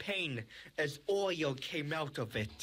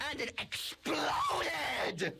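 dramatic high excitement; 11 semitones higher, much slower than usual. Acted. Dramatic crash narration.

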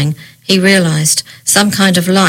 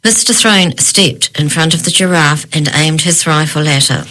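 ordinary delivery, nothing marked

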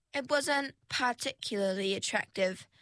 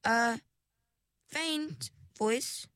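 "faint" set apart, high and drawn out; stress on FAINT; 3 semitones higher, much slower than usual. A narrator's reading. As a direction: lift "faint" well above the rest, with pauses. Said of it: "She emphasized the word faint".

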